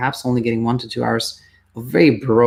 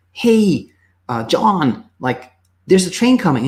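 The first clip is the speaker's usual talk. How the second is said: calling out; 6 semitones higher, 3 dB louder, slower than usual. Pretend. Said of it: "and you go".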